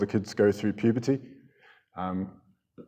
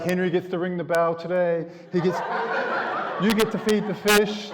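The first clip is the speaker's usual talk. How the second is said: doting teacher; 6 semitones higher than usual. Retold or imitated. Teacher favouring the boy now.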